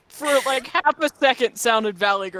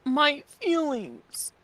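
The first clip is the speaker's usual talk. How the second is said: slow, whiny mock hurt; drawn out, pretend wounded; mock hurt whine; 6 semitones higher, 5 dB quieter, much slower than usual.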